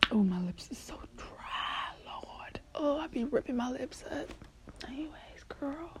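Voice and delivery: soft unvoiced whisper trailing almost silent; barely any voice left, just breath; hoarse whisper. Real, not acted. Dry-lipped and voiceless, whispering to her viewers. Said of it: "do you hear my voice?".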